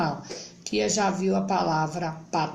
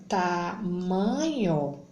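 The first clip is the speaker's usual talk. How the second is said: slow reading aloud; much slower than usual. Shown as a demonstration. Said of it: "E a outra".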